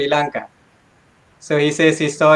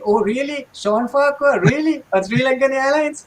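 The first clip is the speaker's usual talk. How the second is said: teasing mock disbelief; 9 semitones higher than usual. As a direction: rib him with fake wonder. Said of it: "pulling his leg saying".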